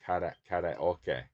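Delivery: karaoke as "kah-rah-oh-keh", Japanese accent; Japanese-accented karaoke, evenly stressed. Shown as a demonstration. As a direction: say karaoke with Japanese vowels and tapped R. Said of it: "say stuff in that kind of accent".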